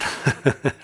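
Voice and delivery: a quick bright laugh; brief real laugh; short laugh. Real, not acted. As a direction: laugh at your own remark. Laughs at his own point.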